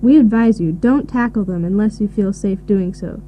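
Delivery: ordinary talk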